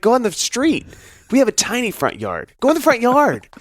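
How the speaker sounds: dad lecturing his sick daughter, high and exasperated; exasperated rant. Retold or imitated. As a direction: rant at the kid, pitch climbing. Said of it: "I'm like".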